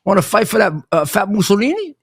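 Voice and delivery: lightly Italian-accented mocking question. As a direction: imitate an Italian grandfather needling someone. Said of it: "he would say in his Italian accent".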